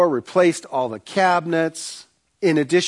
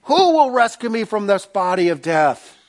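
anguished Paul; 9 semitones higher than usual. Retold or imitated. Paul cries out his struggle in Romans, anguished. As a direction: wail the confession, stretching the shout. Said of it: "Read Romans seven".

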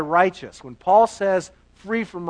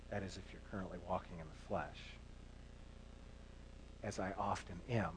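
much softer, quick confession under the breath; hushed aside; 12 semitones lower, 23 dB quieter, faster than usual. Real, not acted. Preacher admitting his own flesh to the congregation, hushed. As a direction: let it slip out almost to yourself.

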